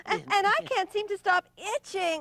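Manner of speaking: high, fast whimpering complaint; whiny high zebra. Acted. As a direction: whine it like an itchy child. Zebra whines about itching.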